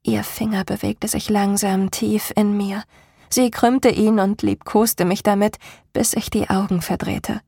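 usual speaking voice

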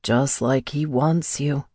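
slow, cold taunt; cold menace. A narrator's reading. Captor taunting her about his brother, slow and cold. Damon's voice continues.

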